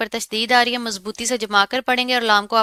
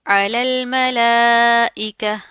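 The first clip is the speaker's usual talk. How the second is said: slow, louder Quran recitation; 4 dB louder, much slower than usual. Shown as a demonstration. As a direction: chant it slowly like tilawat. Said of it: "अब यहाँ पर क्या है?". (धीमी तिलावत)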